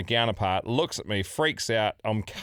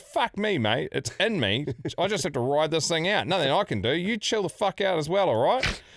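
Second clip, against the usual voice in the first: higher, casual don't-care tone; laid-back bravado; 6 semitones higher than usual. Retold or imitated. Patient calming the panicked medic, mock laid-back. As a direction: shrug it off like a chilled Aussie. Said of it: "I said".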